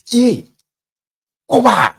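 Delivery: high, loud call to stop him; sudden high outraged yell; shocked yell. Retold or imitated. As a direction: shout his name to stop him. Bystander yells at slapper.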